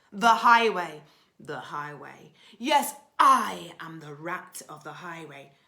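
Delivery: loud booming robber rat; deafening bellow. Acted. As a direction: bellow it to stop them dead. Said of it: "bellowed a deafening".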